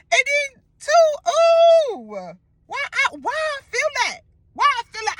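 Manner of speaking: high, breaking, distressed voice; freaked out. Retold or imitated. Recalling feeling the scratch in his dream, high distressed whine. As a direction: whine it in disbelief. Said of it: "freaking the fuck out".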